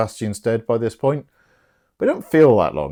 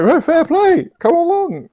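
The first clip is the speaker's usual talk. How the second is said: very high, loud put-on voice; hammed-up high-pitched welcome; mock eager Olivia; 4 dB louder than usual. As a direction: trill it as a delighted lady. Voicing Olivia accepting eagerly.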